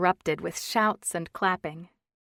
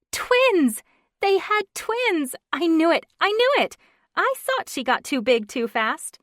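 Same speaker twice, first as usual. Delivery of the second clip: much higher, louder, thrilled shouting; excited shout; 10 semitones higher, 8 dB louder than usual. Acted. Stephanie shouting about the twins to the room, high and loud. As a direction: burst out with delighted disbelief. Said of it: "Then Stephanie shouted".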